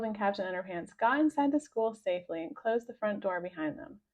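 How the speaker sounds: ordinary delivery, nothing marked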